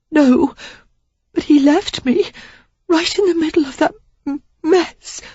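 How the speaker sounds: Sarah confessing to Derek while crying; tearful. A narrator's reading. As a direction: stammer through tears. Sarah crying about Jenner.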